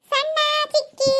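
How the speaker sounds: high, slow, stretched kiddie voice; child's singsong answer. Acted. Pupil telling madam who led the game, high drawn-out voice.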